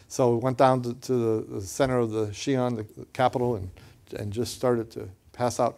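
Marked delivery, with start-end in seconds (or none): none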